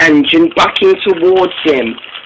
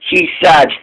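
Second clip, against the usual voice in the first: ordinary delivery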